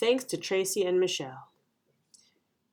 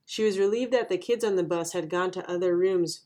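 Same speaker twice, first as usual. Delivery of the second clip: ordinary delivery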